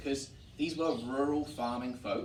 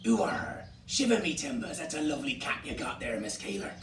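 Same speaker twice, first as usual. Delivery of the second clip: hearty West Country pirate growl on "Ooh"; West Country pirate accent. Shown as a demonstration. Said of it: "this West Country pirate".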